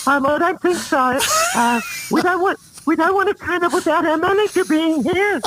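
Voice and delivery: high, squeaky, nervous protest. Retold or imitated. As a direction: voice jumps up high and tight. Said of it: "my vocal went up, up a lot".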